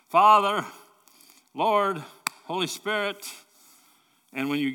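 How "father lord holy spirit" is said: raised, higher-pitched cry out to God; yelling for help. Retold or imitated. Believer in trouble calling on his Father, yelling. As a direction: call out loudly, each name its own plea. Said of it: "I'm yelling".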